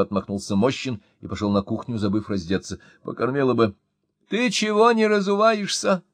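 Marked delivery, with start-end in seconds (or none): 4.3-6.0 shouting wife. A narrator's reading. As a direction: yell at him to take his shoes off. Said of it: "закричала вслед мужу Мощина".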